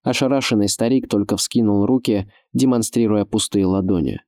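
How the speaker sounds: plain reading voice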